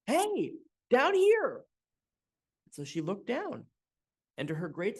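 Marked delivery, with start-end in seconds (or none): 0.1-1.7 high, slow little voice calling up; high bucket voice. A narrator's reading. Bucket on the porch calls the woman, high and slow. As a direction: call up from the floor in a tiny high voice.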